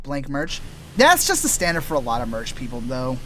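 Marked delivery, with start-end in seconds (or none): none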